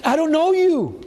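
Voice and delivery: high-pitched exasperated protest; mock indignant outburst. Teacher protests to the crying kid, high mock indignation.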